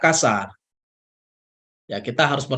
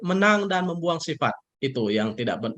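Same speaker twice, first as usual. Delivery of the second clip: usual speaking voice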